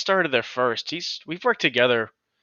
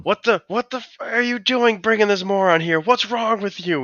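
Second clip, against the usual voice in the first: hammed-up drunk boyfriend yelling; 9 semitones higher than usual. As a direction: drunk boyfriend yelling at his girlfriend about the coworker. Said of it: "He's, like, yelling at her".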